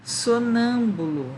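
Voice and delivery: Sonâmbulo said slowly and firmly, so-NÂM-bu-lo; slow sounding-out. Shown as a demonstration. Teacher presenting the word to find its tonic syllable, slow so-NÂM-bu-lo. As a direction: draw out Sonâmbulo, stressing NÂM. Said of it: "Qual é a sílaba tônica mesmo?".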